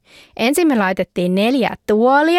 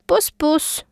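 high, cooing little kiss voice; sweet mock smooch sounds; kissing dogs; 7 semitones higher than usual. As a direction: coo it like a smitten puppy.

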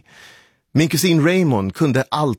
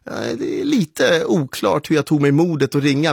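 usual speaking voice

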